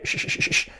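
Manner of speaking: repeated short "sh" bursts without voice; isolated SH sound. Shown as a demonstration. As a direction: hush out "sh", over and over. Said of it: "la S H". (sonido "sh" aislado y repetido)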